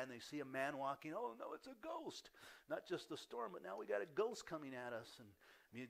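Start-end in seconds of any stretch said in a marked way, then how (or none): 1.1-5.1 high-pitched acted alarm; mock fearful cry. Disciples seeing a ghost on the water, acted fearful cry. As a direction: cry out like a spooked sailor, hammed up. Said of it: "began to cry out in fear".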